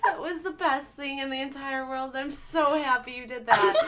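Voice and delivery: laughing through the words; amused delight. Real, not acted. Co-host praising podcaster's demon impression, laughing through it. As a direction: gush while still giggling.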